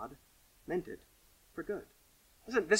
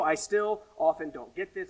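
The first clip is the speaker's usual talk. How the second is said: ordinary delivery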